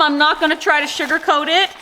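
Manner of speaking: usual speaking voice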